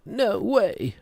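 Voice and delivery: high-pitched fake amazement; mock disbelief. Streamer reacting to silly internet song, mock disbelief. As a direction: feign astonishment, voice leaping up.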